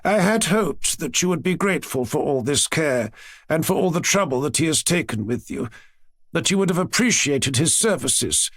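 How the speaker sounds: rehearsed scolding speech from a grandmother; formal, reproachful lecture; prepared reproach. A narrator's reading. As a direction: deliver a prepared rebuke, pitch climbing on the accusations. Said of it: "tone which clearly showed that her speech had been prepared".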